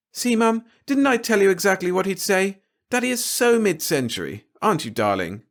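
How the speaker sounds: put-on high, playful young woman; teasing daughter. Glory teasing her father.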